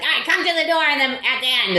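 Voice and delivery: high put-on voice of a curt door lady; mock rude door lady. Lady at the door brushing her off, high put-on voice. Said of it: "she was a horror show to me".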